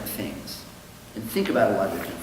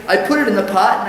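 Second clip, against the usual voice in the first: higher, louder and quick, stammering; flustered explanation; 8 semitones higher, 8 dB louder, faster than usual. Acted. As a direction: rush it out nervously. Goldstein explaining, joke.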